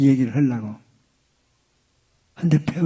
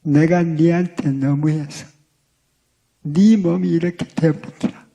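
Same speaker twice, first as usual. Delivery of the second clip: Gyeongsang dialect. Retold or imitated. Husband holding wife's hands, apologizing in Gyeongsang dialect. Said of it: "경상도 남자들 하는 말투".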